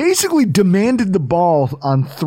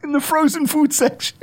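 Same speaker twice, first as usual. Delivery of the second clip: mock threat; 7 semitones higher than usual. Imagined threat, comic.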